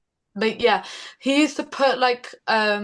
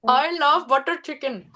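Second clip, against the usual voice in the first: higher, lilting Indian accent; 4 semitones higher than usual. Shown as a demonstration. Singer showing off accents on the host's request, Indian accent. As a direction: put on an Indian accent, pitched up. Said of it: "Can you do an Indian accent?".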